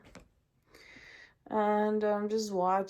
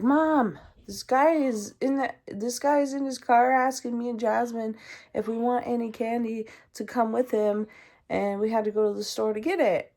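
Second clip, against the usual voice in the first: louder, higher rushed report; urgent yell to mom; 4 semitones higher, 6 dB louder than usual. Retold or imitated. Girl telling her mom about the stranger, yelling. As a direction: blurt it all out to Mom in alarm. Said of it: "I'm yelling".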